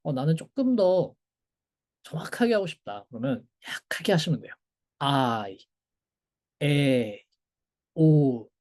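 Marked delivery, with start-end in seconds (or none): 5.0-8.5 아이, 에, 오를 이오타가 거의 안 들리게 살짝; 이오타를 들릴락 말락 약하게 붙여 읽기. Shown as a demonstration. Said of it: "들릴랑 말락하게 살짝 하시면 됩니다". (이오타를 아주 약하게 살짝 넣은 발음)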